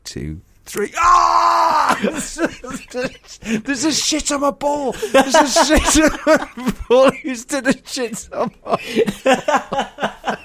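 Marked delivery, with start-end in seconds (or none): 1.0-2.0 high startled yell of disgust; shocked scream on discovering the mess; son's scream. Retold or imitated. Son finds the ruined ball. 2.0-10.4 high, horrified, drawn-out wail; outraged son. Retold or imitated. Son discovering the ruined ball.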